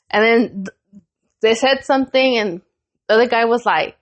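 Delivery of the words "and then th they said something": ordinary talk, nothing marked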